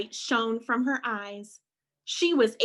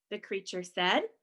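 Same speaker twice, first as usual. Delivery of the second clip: plain reading voice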